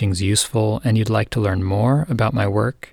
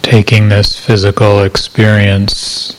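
calm, measured meditation voice with long silences; guided meditation; 6 dB louder than usual. Acted. Meditation teacher settling listeners into body awareness, calm guided meditation. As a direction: guide listeners gently into body awareness, unhurried, evenly paced.

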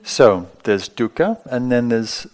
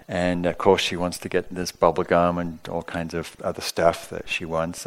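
normal talking voice

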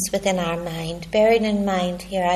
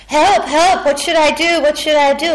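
higher, louder panicked cries; boy shouting for help; 7 semitones higher, 6 dB louder than usual. Retold or imitated. Boy in the nightmare shouting for help, higher and louder. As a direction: yell for help like a frightened child. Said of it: "the little boy starts shouting".

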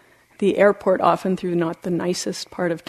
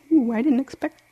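high, breaking into a whisper; overcome, voice giving way into breath; choked up; 10 semitones higher, 3 dB louder than usual. Real, not acted. Speaker to listeners, unexpectedly overcome mid-talk, choked up.

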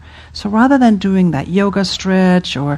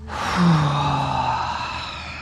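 soft, long sounded exhale; long sigh; 4 semitones lower, 7 dB quieter than usual. Shown as a demonstration. Teacher leading the class's breath, long soft sigh. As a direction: let the breath fall out slowly in a sigh. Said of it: "give your breath a little bit of sound".

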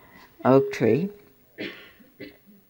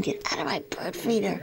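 softer whispered replay of the shout; 4 semitones higher, 8 dB quieter than usual. Retold or imitated. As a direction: breathe "get out of my bird feeder" in a whisper. Recapping the squirrel shout quietly.

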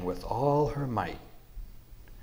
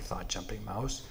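plain reading voice

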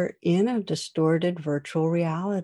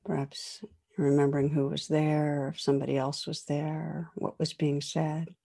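soft spoken reflection prompt; guided meditation; 3 dB quieter than usual. Acted. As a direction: guide listeners through calm reflection.

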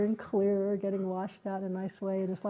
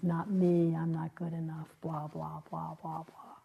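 mock self-doubt; 2 semitones lower than usual. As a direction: brush the thought off, fading away. Mocking petty self-doubting thoughts.

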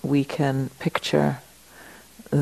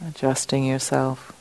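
slightly lowered, soft meditation voice with long pauses; guided meditation; 3 dB quieter than usual. Acted. Teacher settling the group into the room, guided meditation. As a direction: settle them in gently, leaving space between phrases.

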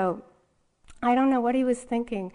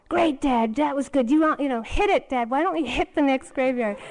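louder, higher, sarcastic jab at Dad; angry sarcasm; 5 semitones higher, 5 dB louder than usual. Retold or imitated. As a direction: snap back at Dad with biting sarcasm. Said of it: "I just got really angry back".

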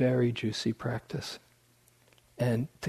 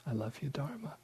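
the phrase I love you, Dharma breathed out softly and much quieter, as if meant at last; heartfelt hush; 3 semitones higher, 13 dB quieter, faster than usual. Retold or imitated. To his teacher, finally feeling it: a soft heartfelt hush. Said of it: "one time I really felt it".

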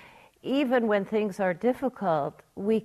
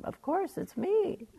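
much higher, silly and obvious; mock naive voice; 11 semitones higher than usual. Teacher mocking the obvious answer in a silly high voice. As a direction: squeak it out as if it were obvious.

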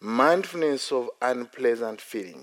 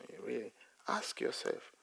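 whispered; 13 dB quieter than usual. Real, not acted. Inner questioning.

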